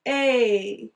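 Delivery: isolated long a sound held out. Shown as a demonstration. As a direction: model the long A vowel clearly. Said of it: "that long A vowel digraph sound".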